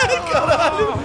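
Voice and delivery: loud nervous laugh-scream; panicked laughter. Real, not acted. Laughs during chase.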